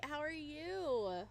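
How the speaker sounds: normal talking voice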